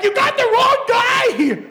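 high, loud, slow, laughing protest. Retold or imitated. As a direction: plead through laughter. Protest to the officer.

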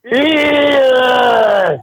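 high shouted zombie wail; zombie noise. Pretend.

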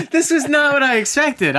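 high surprised laugh. Real, not acted. Surprised at a friend's opinion.

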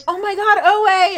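high, loud delighted cry; acted thrilled recognition, loud and high; excited reunion greeting. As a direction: call out to a long-lost buddy. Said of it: "Halstead's like".

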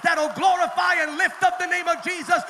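loud, high, shouted sermon climax; bellowing the name of Jesus to the congregation; full-force preaching. Acted. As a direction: preach it to the rafters, hammering every line.

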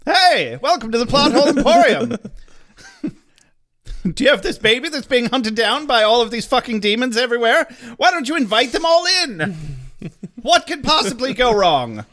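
high, peppy fake sales pitch; mock commercial announcer. Improvising a Plot Hole Emporium ad, hammed-up announcer. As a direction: sell it like an over-eager store ad.

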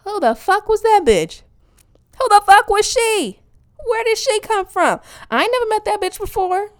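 high, loud, incredulous outburst; mock outraged disbelief. Comedian aghast at her own interview persona, incredulous. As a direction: demand answers in exaggerated bafflement.

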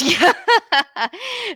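real high-pitched laugh; amused laugh, pitched well up. Real, not acted. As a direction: burst out laughing at being teased. Reacts to co-host's teasing jab.

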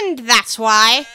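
loud, slow, haughty duchess; imperious plummy grande dame. Acted. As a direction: boom it out like a grand lady. Duchess voice held.

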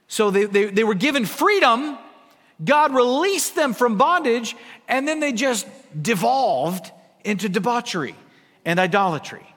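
hammering each point home from the pulpit; fervent preaching. Acted. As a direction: preach it with rising fire. Preaching about Israel's freedom.

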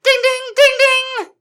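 very high, ringing dings; imitated bike bell. Rider pedaling away on a velocipede, high bell imitation. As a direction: chime it out like a bicycle bell.

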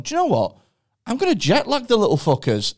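high-pitched, defiant imagined scientist; fed-up scientist. Retold or imitated. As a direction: announce the petty plan as the scientist. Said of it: "a scientist has had a conversation where he's gone".

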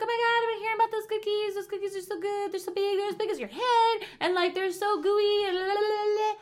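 high, excited imitation of a friend; gush, stretching into a sing-song; hyped gushing. Friend raving about gooey cookies, hyped gushing.

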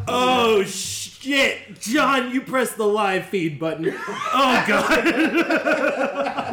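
high, loud, laughing alarm; laughing panic. Real, not acted. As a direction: laugh in dismay. Realising they are live.

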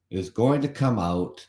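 normal talking voice

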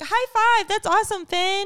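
high, bright high-five cheer; cheery praise. Retold or imitated. Mom imitating the teacher cheering her daughter on. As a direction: praise a child with big enthusiasm. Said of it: "she was like".

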